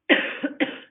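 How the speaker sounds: rough repeated cough. Real, not acted. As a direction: cough it out hard. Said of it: "I'm so sorry for coughing".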